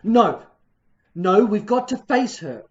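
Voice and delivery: loud, forceful no; firm command. Acted. Husband orders his frightened wife to stay, loud and firm. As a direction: stand your ground. Husband firmly refuses to retreat.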